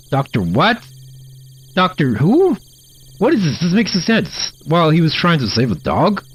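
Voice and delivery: high incredulous disbelief; baffled, voice shooting up. Real, not acted. Baffled by the game text.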